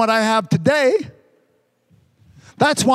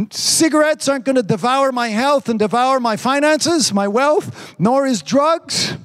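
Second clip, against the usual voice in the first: ordinary delivery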